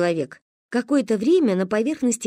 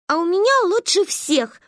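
put-on high, excited little-girl brag; boastful child; 9 semitones higher than usual. A narrator's reading. Manya jumping round her friends, gleeful boasting. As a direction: jump in with a high-pitched boast. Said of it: "прыгала вокруг нас Манька".

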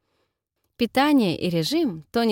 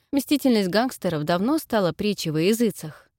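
usual speaking voice

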